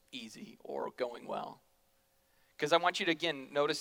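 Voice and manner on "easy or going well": soft, slow, breathy aside; whisper. Real, not acted. Preacher drops to a whisper on hard times. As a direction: let the words fall to a hush.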